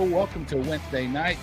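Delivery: ordinary talk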